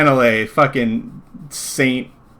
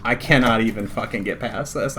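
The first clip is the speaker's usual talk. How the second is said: normal talking voice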